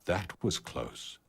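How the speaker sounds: hushed, under the breath; quiet aside. A narrator's reading. Rabbit whispering to his dead companion, hushed. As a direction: murmur it low and close, relieved. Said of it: "said Frank silently".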